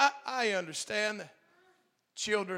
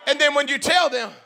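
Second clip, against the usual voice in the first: loud, high preacher's shout; full-force preaching; 8 semitones higher, 12 dB louder than usual. Acted. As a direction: preach it out to the whole congregation.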